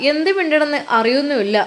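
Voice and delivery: ordinary delivery, nothing marked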